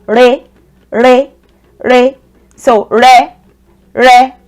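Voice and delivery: "re" held on a level middle pitch. Shown as a demonstration. Teacher contrasting the re tone with do. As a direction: say "re" one step up and level. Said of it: "aligns with the re tone".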